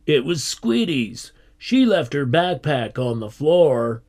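protesting. A narrator's reading. Tony blaming Squiddy for the backpack, protesting. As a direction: pass the blame indignantly. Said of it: "protested Tony".

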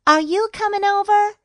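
high, loud, quick call; calling over to a friend. A narrator's reading. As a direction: shout the question from afar. A friend calls to Jake.

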